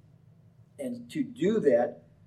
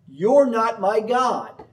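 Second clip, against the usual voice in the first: slow, louder, higher declaration; firm defiance; 7 semitones higher, 7 dB louder, slower than usual. Retold or imitated. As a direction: plant your feet and refuse. Micah defies the prophets.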